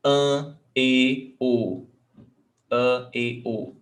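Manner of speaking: An, e, o as closed vowels, one by one. Shown as a demonstration. Teacher drilling circumflex sounds, closed vowels. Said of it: "It makes a closed vowel sound".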